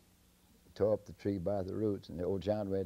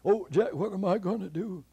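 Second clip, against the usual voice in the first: high, loud wail; high wailing giant; fretting giant; 13 semitones higher, 9 dB louder than usual. Acted. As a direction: cry out in helpless worry. Giant despairs.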